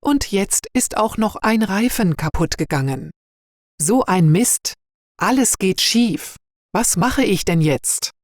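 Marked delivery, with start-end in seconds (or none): none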